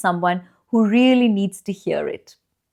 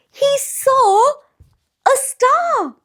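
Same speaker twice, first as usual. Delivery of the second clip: excited discovery; 3 dB louder, much slower than usual. A narrator's reading. Boy finally spots star.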